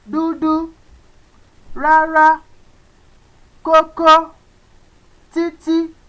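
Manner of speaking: "wá", "fẹ́", "dúdú" said high and level; high tone words. Shown as a demonstration. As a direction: keep every word up on the high tone. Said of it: "let's pronounce words with upper tone".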